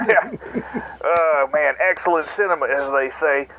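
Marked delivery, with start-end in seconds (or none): none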